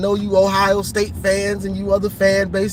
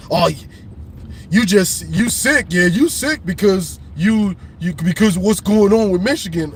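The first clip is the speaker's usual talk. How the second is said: mock commenter; 3 semitones lower than usual. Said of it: "people running with this scandal".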